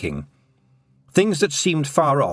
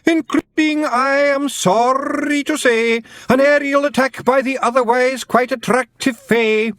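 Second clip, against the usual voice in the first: recited verse; 14 semitones higher, 4 dB louder than usual. A narrator's reading.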